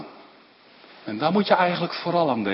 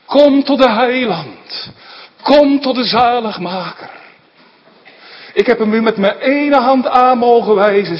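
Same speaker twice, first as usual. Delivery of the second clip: fervent preaching; 5 semitones higher, 5 dB louder than usual. Acted. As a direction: plead with the congregation to come. Preacher's urgent altar call. (vurige prediking, smekend en luid roepend)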